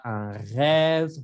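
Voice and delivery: "rêve" with a long open è. Shown as a demonstration. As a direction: stretch the vowel of "rêve", mouth opening. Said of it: "ven, mi boca se abre". ("rêve" con è abierta y alargada)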